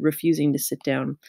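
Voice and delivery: ordinary delivery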